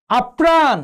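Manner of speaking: আপ্রাণ as ap-praan; careful repeat of আপ্রাণ with a held p; doubled consonant. Shown as a demonstration. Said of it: "দ্বিত্ব উচ্চারণ হয়েছে".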